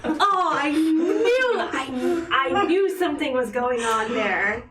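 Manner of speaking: excited. Real, not acted. Excited reaction to gossip.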